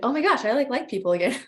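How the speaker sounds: thrilled to discover it; delighted realisation. Retold or imitated. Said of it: "But I was like".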